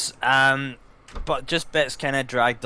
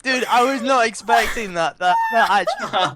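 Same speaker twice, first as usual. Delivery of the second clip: high, loud astonishment breaking into laughter; real giddy disbelief; amused surprise; 9 semitones higher, 5 dB louder than usual. Real, not acted. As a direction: react as if caught totally off guard, laughing.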